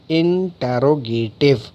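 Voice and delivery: इंटेरोगेटिव slowly, syllable by syllable; spelling-led pronunciation. Shown as a demonstration. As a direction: spread out इंटेरोगेटिव as in-te-ro-ge-tiv, following the spelling. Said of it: "देखिए आप spelling भी देखिए". (इंटेरोगेटिव, हर अक्षर अलग और धीरे बोलकर)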